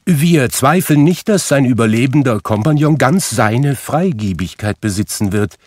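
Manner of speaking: polite portly gentleman. A narrator's reading. Charity gentleman addressing Scrooge politely. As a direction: ask with stiff politeness.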